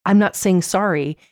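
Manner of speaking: normal talking voice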